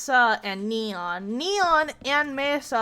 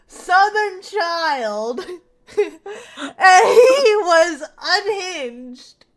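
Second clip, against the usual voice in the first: laughing; 6 semitones higher, 7 dB louder, much slower than usual. Real, not acted. Laughing while describing hillbilly kid.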